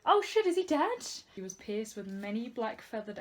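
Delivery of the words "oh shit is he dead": higher and louder in sudden alarm; shocked. Real, not acted. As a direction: react in real surprise. Shocked reaction while reading.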